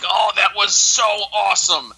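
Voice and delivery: high, drawn-out excited gush; excited reaction. Retold or imitated. Viewer reliving a favorite Super Bowl commercial, excited. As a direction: gush slowly, high-pitched, reliving the thrill. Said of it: "I was all excited".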